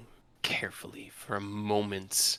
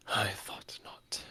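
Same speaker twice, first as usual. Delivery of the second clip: villain whisper; 5 dB quieter, slower than usual. Acted. Villain NPC reply.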